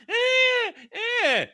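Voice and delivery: high piercing mock scream. Friend mimics the screaming woman in a high mock scream. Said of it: "She's going".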